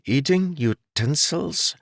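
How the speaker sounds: slow, high, baffled repeat of eating utensils; puzzled echo. A narrator's reading. Jarek hearing about forks for the first time, baffled. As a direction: turn the strange phrase over, confused.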